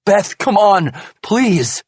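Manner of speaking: high, loud pleading. A narrator's reading. Husband begs wife to calm down, pleading. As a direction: beg her to stop.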